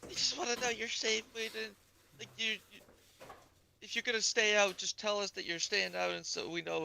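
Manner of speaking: high-pitched imitation of a concerned parent; put-on high parent voice; mock parent voice. Voices parents wanting him safe.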